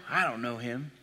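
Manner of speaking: preacher voicing Peter's quick soft denial; quiet mock denial. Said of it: "talk like I'm talking right now".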